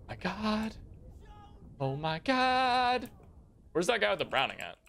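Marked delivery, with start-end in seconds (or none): 0.1-3.1 relieved disbelief. Real, not acted. Relief after surviving.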